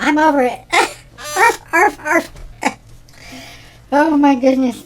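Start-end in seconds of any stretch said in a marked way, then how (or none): 0.0-0.6 higher, louder sassy imitation; husband teasing her about cooking, sassy; mock husband. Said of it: "He's like". 0.7-2.3 high, loud yappy barks; playful dog imitation; mock barking. Barking after laugh.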